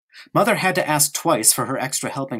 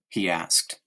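usual speaking voice

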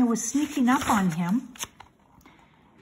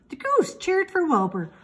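plain reading voice

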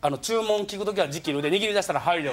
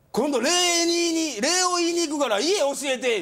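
put-on slow, higher old man; 9 semitones higher, 3 dB louder, slower than usual. Old man asking the teller's address, slow and higher. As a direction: stretch it out like a fawning old man.

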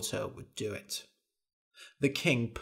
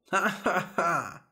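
short raised ha-ha-ha; fairy's laugh; 5 semitones higher than usual. A narrator's reading. Fairy laughing at the visiting king, short staged laugh. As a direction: give a brief mocking stage laugh. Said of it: "The fairy laughed".

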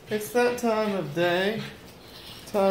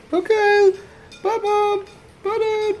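much higher, cutesy dog voice; voicing the dog. Owner voices her dog saying bye to mom and dad, much higher. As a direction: speak for the dog in a high squeaky voice.

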